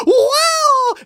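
loud, very high screech; upset peacock cry. Acted. Storyteller voicing upset morning peacock, loud wail. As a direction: shriek like a distressed peacock. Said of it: "everyone was so upset".

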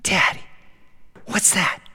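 put-on whispered child's question; hushed wonder. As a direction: whisper it in curiosity. Daughter wonders at the rain.

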